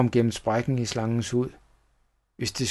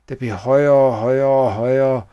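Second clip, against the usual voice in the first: slower, louder, mounting repetition; swelling narration; 6 dB louder than usual. A narrator's reading. Narrator describing the wail getting louder, swelling intensity. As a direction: push each "højere" out with growing force.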